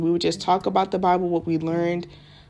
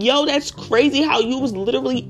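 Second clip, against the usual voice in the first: re-enacted amazement; 5 semitones higher than usual. Quoting amazement at classmate.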